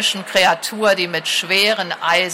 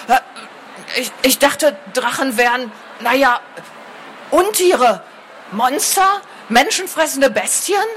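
much higher, halting and nervous; flustered stammer; 6 semitones higher than usual. A narrator's reading. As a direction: stumble over the words, rattled. Scribe flustered facing talking dragon. (verunsichert stammelnd)

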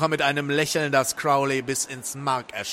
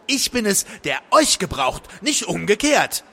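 insistent retort; 6 semitones higher, 3 dB louder than usual. A narrator's reading. Visitor or Crowley asserts himself.

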